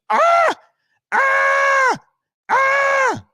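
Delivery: three high, loud screaming ahs; hammed-up horror-movie yells; mock scream. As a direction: scream like the shark just got him. Reacting to the shark video.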